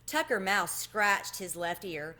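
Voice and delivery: plain reading voice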